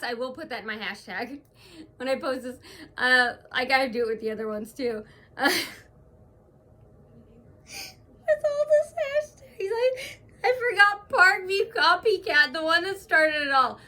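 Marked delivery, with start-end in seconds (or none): 5.4-13.8 high-pitched, laughing through the words; helpless laughter squeezing the voice high; real laughing. Real, not acted. Vlogger cracking up over her hashtag, laughing as she talks. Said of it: "laughing makes you feel better".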